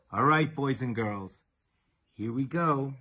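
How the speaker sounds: usual speaking voice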